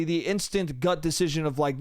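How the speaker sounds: normal talking voice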